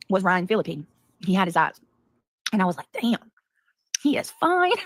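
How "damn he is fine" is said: swooning. Retold or imitated. Retold thought about a crush.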